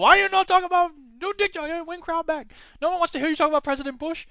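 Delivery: heckling the podcaster in a mock Chinese accent; mock Chinese heckler. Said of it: "Bill Hicks' impression of a Chinese heckler".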